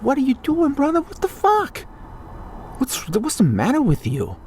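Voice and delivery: squeaking outrage at a brother; incredulous. Acted. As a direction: throw up your hands at him.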